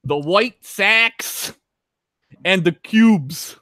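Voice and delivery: put-on slow, high Chicago accent; broad Chicago local voice. Continuing the Chicago accent bit.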